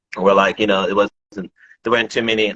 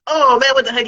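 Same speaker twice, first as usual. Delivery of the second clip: high, louder mock disbelief; surprised; 5 dB louder than usual. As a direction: act out your own shocked reaction, way up high. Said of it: "I'm like".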